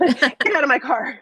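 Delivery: slow, drawn-out mock order to leave the car; hammed-up get out of my car, taken slowly; a playful mock kick-out, slow and deliberate; mock fed-up dismissal. Repeats the line for laughs.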